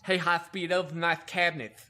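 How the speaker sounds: imitating the chief's bad lisp; mock a heavily lisping boss; really bad lisp. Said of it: "he talked with a really bad lisp".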